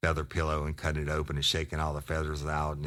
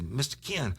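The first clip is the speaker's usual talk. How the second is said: much higher, pleading mock sob voice; imitating a crying kid; 11 semitones higher than usual. Informant begging the cop for help, high mock crying. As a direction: beg like a scared kid, half-hammed. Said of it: "Motherfucker was crying".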